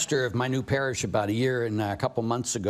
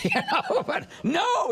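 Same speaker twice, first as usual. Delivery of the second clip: laughing through the words, pitched up; amused laughing aside; slower than usual. Real, not acted. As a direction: chuckle it out high. Laughs while talking.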